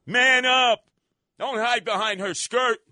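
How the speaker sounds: loud, sharp scolding command; shouted. Real, not acted. Radio host daring the mayor to man up, loud. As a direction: bark it like a challenge.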